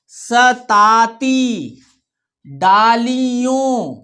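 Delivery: very slow, drawn-out word reading; lecture en chœur. Shown as a demonstration. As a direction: stretch each syllable so pupils can read along. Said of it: "हमारे साथ पढ़ना है आपको".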